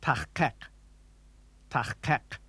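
"Tachkek" with both k's far back in the throat; throaty Klingon k. Shown as a demonstration. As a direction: rasp the ch, burst each k in "Tachkek". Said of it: "new sound at both the beginning and end".

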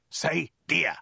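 insistent, threatening soldier; hissed order. A narrator's reading. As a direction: push each word out as a threat. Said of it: "hissed the soldier".

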